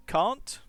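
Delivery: Can't said with a broad ah, can't rising; Can't with a British broad A; demo word. Shown as a demonstration. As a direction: say Can't as kahnt with a long open vowel. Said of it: "let me say some words".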